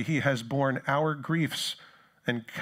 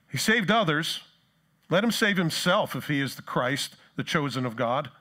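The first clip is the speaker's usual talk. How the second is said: raised, louder jeer easing off at the end; sneering; 4 semitones higher, 5 dB louder than usual. Acted. Rulers sneering at Jesus on the cross, sneering. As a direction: throw it out as a loud, scornful taunt. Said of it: "rulers with them sneered".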